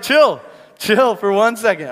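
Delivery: loud comic impatience; mock exasperated command. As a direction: playfully tell a panicker to settle down. God acted calming panicked Moses.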